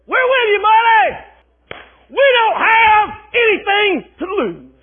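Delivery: high, loud eager voices of the eunuchs; eunuchs calling down. Acted. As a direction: call down from the window to back him. Eunuchs answering Jehu.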